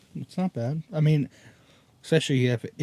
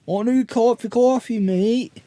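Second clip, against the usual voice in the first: high, loud Australian imitation; exaggerated Australian voice; mock Australian accent; 8 semitones higher, 4 dB louder than usual. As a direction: echo it in hammed-up Aussie. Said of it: "that's Australian".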